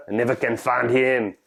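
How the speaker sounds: normal talking voice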